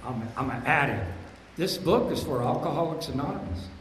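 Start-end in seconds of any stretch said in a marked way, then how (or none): 0.0-1.0 imitating Louis. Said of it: "Louis talked about that".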